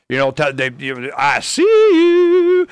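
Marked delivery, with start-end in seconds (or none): 1.2-2.7 very high, loud, slow drawn-out shout; hammed-up creepy I see you; creepy watcher. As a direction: sing it like a spooky spy camera. Imitating spying cameras, drawn out.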